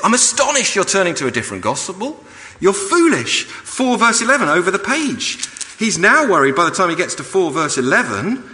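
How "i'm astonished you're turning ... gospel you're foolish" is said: voicing Paul exasperated. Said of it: "He says".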